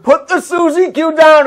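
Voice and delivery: high, loud, slow order; sharp command shout. Retold or imitated. Worker shouting at Greg to drop the snack. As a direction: bark at him to drop it. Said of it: "I was like".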